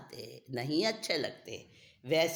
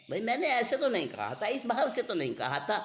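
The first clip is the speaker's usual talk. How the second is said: higher, quicker defensive protest; 7 semitones higher, faster than usual. Acted. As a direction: plead that you were misunderstood. Speaker protests being misunderstood. (सफ़ाई देते हुए विरोध)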